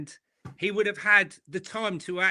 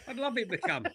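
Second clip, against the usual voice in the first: softer, laughing through the words; 5 semitones higher, 7 dB quieter than usual. Real, not acted. Correcting himself about the friend joining, laughing softly. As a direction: chuckle as you repeat it.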